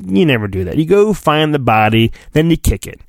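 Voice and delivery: normal talking voice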